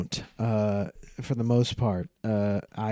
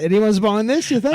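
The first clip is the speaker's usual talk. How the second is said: high, loud mock Aussie; hammed-up Aussie; put-on Australian accent; 10 semitones higher, 6 dB louder than usual. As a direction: press the question in fake Australian. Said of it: "Americans do Australian accents".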